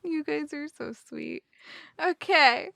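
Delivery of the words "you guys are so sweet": much softer, touched thanks; soft gratitude. Real, not acted. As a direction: say it quietly and sincerely. Quiet repeat of thanks to chat.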